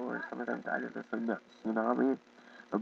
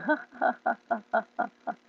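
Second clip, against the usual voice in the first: high, badly imitated laugh; Zach's laugh, butchered; 9 semitones higher, 4 dB louder than usual. Imitating a friend laughing on the floor, butchered high laugh. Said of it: "I just butchered his laugh".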